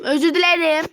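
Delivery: apology that bursts into a shout; accidental shouting. Real, not acted. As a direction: belt out the apology too loudly. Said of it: "gene bağırdım".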